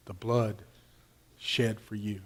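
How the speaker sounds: low, soft minister serving communion; student minister. Retold or imitated. Student minister to a kneeling churchgoer, imitated low and hushed. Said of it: "he got to me and he said".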